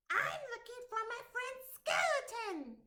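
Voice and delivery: high, eager little goblin voice; squeaky-high goblin searching for his friend. Acted. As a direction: play a small hopeful goblin asking around. Goblin asks farmer.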